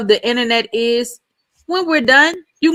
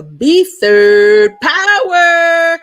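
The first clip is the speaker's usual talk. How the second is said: booming, drawn-out announcer call; 7 semitones higher, 6 dB louder, much slower than usual. Shown as a demonstration. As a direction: stretch it out like a show host. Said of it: "saying it like an announcer".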